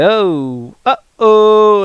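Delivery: comic alarm, rising in pitch; mock dismay. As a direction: act out a cartoonish uh-oh. Retold dismay at looking in box.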